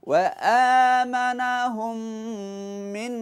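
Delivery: drawn-out chanted recitation; slow melodic Quranic chant. Shown as a demonstration. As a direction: stretch each sound slowly as in tajweed. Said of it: "পারবেন সবাই?".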